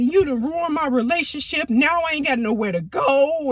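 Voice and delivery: high, mock angry cussing; retold cussing out. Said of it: "he was cussing me out".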